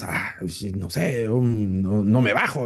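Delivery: mock-hesitant murmur, starting softly; lightly acted dithering. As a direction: imitate an unsure listener, halting between fillers. Acting out unsure congregation members.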